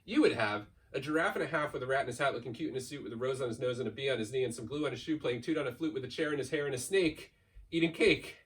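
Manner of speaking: ordinary delivery, nothing marked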